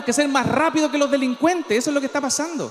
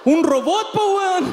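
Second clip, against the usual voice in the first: indignant robot; 7 semitones higher, much slower than usual. Acted.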